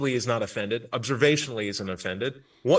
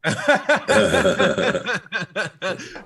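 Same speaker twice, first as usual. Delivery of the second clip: long, real burst of laughter; hearty laugh; 4 semitones higher, 4 dB louder than usual. Real, not acted. Host laughing at a co-host's joke, heartily amused. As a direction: crack up at the joke.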